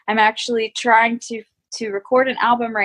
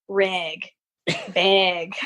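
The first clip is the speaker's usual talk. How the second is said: put-on northern accent; 4 semitones lower, 3 dB quieter than usual. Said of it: "one of my friends is from the north".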